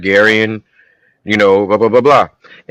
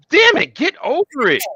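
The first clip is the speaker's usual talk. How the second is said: high-pitched mock protest; exasperated. Exasperated at an unforgiving character.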